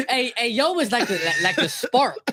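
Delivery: words breaking up in laughter; giggling while explaining; laughing. Real, not acted. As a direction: laugh through the explanation, high. Laughing about yo.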